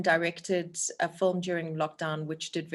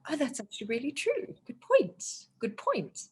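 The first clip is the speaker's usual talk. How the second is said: struck realisation; 7 semitones higher than usual. Retold or imitated. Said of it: "And I was like".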